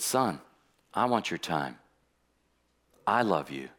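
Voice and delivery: low, soft, tender voice of God. Retold or imitated. Pastor retelling God speaking to him, low and soft. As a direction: speak low and gentle like a loving father.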